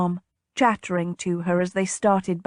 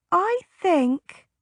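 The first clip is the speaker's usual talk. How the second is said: put-on young girl; 10 semitones higher than usual. A narrator's reading. Young girl voiced in story.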